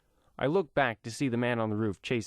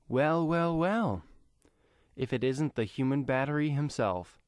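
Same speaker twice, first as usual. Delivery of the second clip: higher, drawn-out mocking well well well; smug greeting; 5 semitones higher than usual. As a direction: savor catching him out. Cloaked brother greets him smugly.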